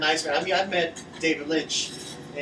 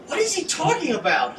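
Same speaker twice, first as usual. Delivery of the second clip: put-on puzzled kids; 12 semitones higher, 3 dB louder than usual. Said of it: "we would just go".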